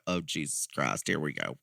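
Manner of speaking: resigned aside, low and soft; weary mutter. Retold or imitated. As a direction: groan it to yourself as trouble starts. Dreading a fight breaking out.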